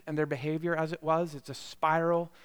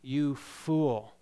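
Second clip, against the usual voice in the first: ordinary talk, nothing marked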